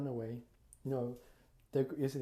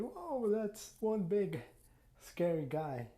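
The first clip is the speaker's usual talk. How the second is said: a little scared; 9 semitones higher, much slower than usual. Acted. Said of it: "Deck was a little bit scared".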